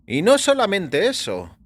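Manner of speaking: lightly voiced doctor musing on the murders. A narrator's reading. A doctor tells his patient about the public's reaction, in a lifted voice.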